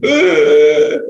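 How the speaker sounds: sharp loud gasp of shock; startled gasp; retold gasp. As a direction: gasp as he comes back to life. Reviving a dead runner.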